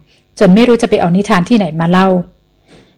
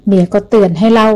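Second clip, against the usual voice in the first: ordinary delivery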